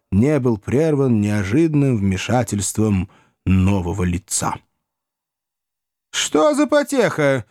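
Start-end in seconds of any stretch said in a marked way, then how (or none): 6.1-7.4 high, drawn-out inquiry; young man's question. A narrator's reading. Newcomer asking about the commotion, high drawn-out question. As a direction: ask it very high and leisurely. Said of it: "спросил довольно высокий худощавый молодой человек".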